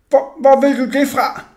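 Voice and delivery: high, loud, stammered question; frightened. A narrator's reading. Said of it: "han så mægtig bange ud".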